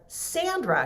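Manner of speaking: high sharp call of Sandra; teacher calling. Retold or imitated. Teacher singling out the daydreamer, high and sharp. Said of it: "And so she said".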